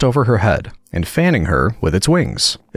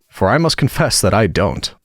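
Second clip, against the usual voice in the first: ordinary delivery, nothing marked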